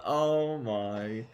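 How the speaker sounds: ordinary talk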